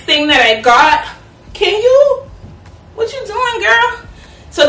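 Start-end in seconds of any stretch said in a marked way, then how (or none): 1.5-4.0 high sing-song voice for the dog; pet talk. Real, not acted. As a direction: coo at the puppy. Talking to her dog Roxy.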